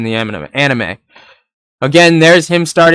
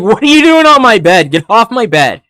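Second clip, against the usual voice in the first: mock indignant yell; 7 semitones higher than usual. Ichigo tells the intruder on his bed to get off, mock outraged. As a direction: sound offended and bossy, hammed up. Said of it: "It's like".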